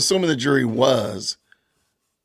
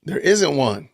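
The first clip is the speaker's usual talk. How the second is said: usual speaking voice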